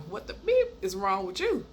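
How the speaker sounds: high-pitched, exasperated question; retold outraged question; incredulous demand. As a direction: confront someone in disbelief, pitch jumping up. Retold confrontation with date.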